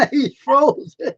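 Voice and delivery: high, slow, laughing through the words. Real, not acted. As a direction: giggle it out.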